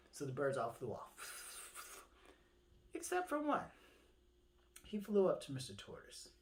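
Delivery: plain reading voice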